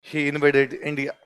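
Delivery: slow and soft, word by word; teacher repeating the line for notes; slow dictation repeated. Shown as a demonstration. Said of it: "लिख लो".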